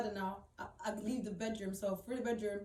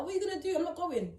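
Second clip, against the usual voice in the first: higher-pitched indignant challenge; retold defiance, pitched up; defiant retort; 7 semitones higher, 3 dB louder than usual. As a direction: stand your ground in a retold row. Acted defiant quote in retold row.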